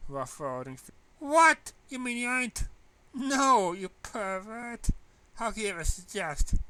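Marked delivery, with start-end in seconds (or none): 1.2-2.7 very high, loud incredulous cry; can't believe her ears; a shrill loud what of disbelief; shocked what. Acted. 3.1-6.6 high, loud and slow indignant no; drawn-out scandalised protest; outraged denial. Acted.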